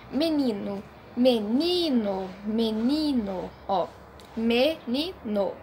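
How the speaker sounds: menino drawn out slowly, NI leaned on; stress on NI. Shown as a demonstration. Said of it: "A nossa sílaba mais forte é o ni". (menino devagar, forte no NI)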